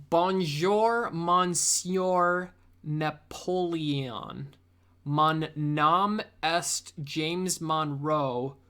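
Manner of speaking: slow, over-careful schoolbook French; American sounding out phrasebook French; hammed-up American French accent; bad tourist French. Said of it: "You know I speak English".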